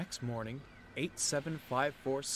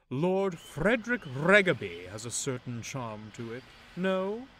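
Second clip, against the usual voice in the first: louder, slow, self-important tone; pompous musing; 4 semitones higher, 8 dB louder than usual. Acted. Loaned engine musing to another engine, pompous. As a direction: savour a grand name for yourself.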